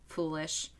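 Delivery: soft, low menacing verdict; dark lord. Retold or imitated. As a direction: pronounce doom quietly. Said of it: "as Kaoru says".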